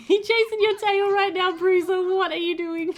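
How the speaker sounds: high, amused puppy talk; delighted cooing. Real, not acted. Player watching Bruiser chase his tail, delighted cooing.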